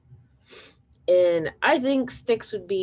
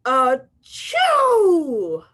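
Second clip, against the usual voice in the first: a huge, loud, higher achoo; huge sneeze; 8 semitones higher, 10 dB louder than usual. Acted. Wolf sneezing at the brick house, a huge loud achoo. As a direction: let rip one enormous sneeze.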